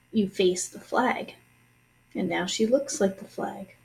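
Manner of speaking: plain reading voice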